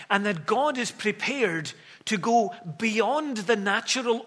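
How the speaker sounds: fervent preacher proclaiming to the congregation; preaching at full force. Acted. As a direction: build the sermon to a ringing climax. Preaching climax, raised voice.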